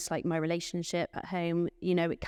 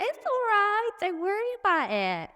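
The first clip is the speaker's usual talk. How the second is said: much higher, louder sing-song comfort; imitating her reassurance; 4 dB louder than usual. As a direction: chirp it out to a worried staff member. Voicing herself reassuring a colleague.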